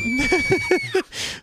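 high squealing burst of laughter; shrieking laugh. Real, not acted. Laughs at bidet joke.

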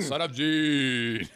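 drawn-out, loud mock announcement; sound-effect voice. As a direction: boom it out slowly like a sound effect. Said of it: "the whole sound effect thing".

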